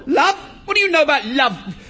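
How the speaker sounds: loud, high-pitched incredulous fury; outraged shout. Acted. Husband challenging his wife about love, outraged. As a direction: explode at the word, then challenge.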